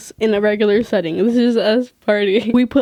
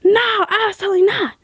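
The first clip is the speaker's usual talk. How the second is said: high-pitched imitation of a rude refusal; mock yelling manager; 10 semitones higher than usual. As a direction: mimic a bossy manager shutting them down. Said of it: "yelled at me".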